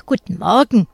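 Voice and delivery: ein trotziges, etwas lauteres Guten Morgen. A narrator's reading. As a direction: sag es bockig zur Lehrerin. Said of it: "Etwas trotzig fügt sie hinzu". (leicht trotzig)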